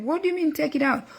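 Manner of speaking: quick, high, disbelieving question; puzzled question. Retold or imitated. Mother pressing her son on what he meant, quick and baffled. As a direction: fire it back fast, baffled.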